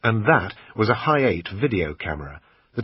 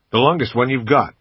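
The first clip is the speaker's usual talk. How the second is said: put-on a louder American accent, kept up while haggling; bad American accent; 4 semitones higher, 7 dB louder than usual. Brit quizzing the shop assistant, bad American accent. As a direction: keep the clumsy American put-on going. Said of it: "in my usual bad American accent".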